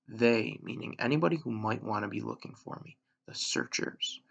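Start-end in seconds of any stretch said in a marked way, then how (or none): none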